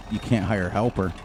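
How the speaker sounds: ordinary talk, nothing marked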